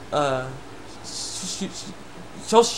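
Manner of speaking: normal talking voice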